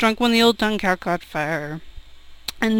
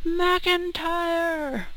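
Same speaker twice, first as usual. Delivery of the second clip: shouted name; 6 semitones higher, 9 dB quieter than usual. Acted. Drinker in the cellar yells for his mate, shouting. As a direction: shout the name out, pitched higher. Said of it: "somebody shouted McIntyre".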